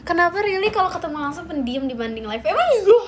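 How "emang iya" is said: high, loud surprised question. Real, not acted. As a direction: jump up in surprise. Surprised reply to chat.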